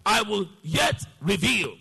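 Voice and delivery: high, slow proclamation with pauses; ringing, measured scripture declaration; emphatic preaching. Real, not acted. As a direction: declare it slowly, landing on each key word. Repeats the verse as proclamation.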